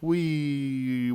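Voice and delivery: ordinary delivery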